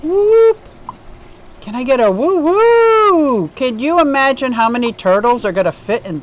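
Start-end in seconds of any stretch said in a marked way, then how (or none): 0.0-3.5 high, loud cheer of delight; excited whoop. Real, not acted. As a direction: whoop with real joy at the gift. Excited at gift reveal.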